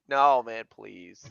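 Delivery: usual speaking voice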